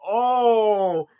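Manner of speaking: loud, high, drawn-out oh; dismayed groan. Retold or imitated. Viewer reacting to Koichi's blunder, loudly dismayed. Reacting aloud to the awkward overheard remark.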